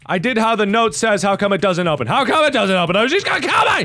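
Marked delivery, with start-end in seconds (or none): 2.1-3.8 from puzzled to high-pitched shouting; rising panic. Real, not acted. Player stuck at the door, panic rising to shout. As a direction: build into a panicked yell.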